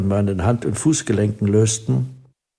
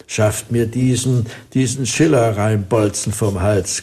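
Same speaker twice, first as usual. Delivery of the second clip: ordinary delivery, nothing marked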